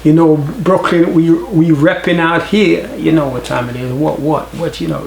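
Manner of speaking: New York accent. Shown as a demonstration. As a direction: imitate a New York Brooklyn guy repping his block. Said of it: "I'll be like".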